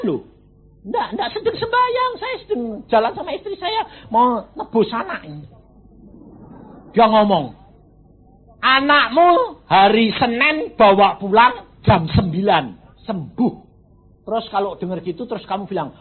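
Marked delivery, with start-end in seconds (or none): 0.9-5.4 much higher, fast excited protest; high-pitched excited aside; excited insistence. Real, not acted. 8.6-13.5 loud, slow, high proclamation; slow booming voice of God; booming divine voice. Acted. Preacher voices God's promise.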